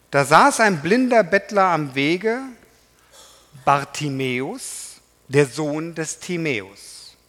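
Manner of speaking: loud, measured Bible reading with rising phrase ends; solemn public reading of scripture. Acted. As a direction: proclaim the passage to the congregation. Solemn scripture reading, first take.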